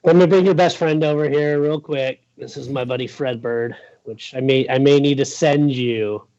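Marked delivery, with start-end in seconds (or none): none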